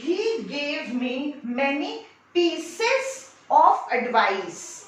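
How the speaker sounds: very slow, word by word dictation; slow example sentence. Shown as a demonstration. Teacher dictates an example sentence very slowly. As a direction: read it out slowly so students can write it. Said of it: "जैसे".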